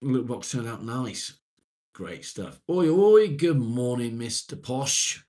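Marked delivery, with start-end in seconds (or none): 2.7-5.2 shouted cheery hello, much higher and louder; boisterous mock-grand greeting. Real, not acted. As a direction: call out a big jokey hello to Mister Posh. Loud greeting to viewer.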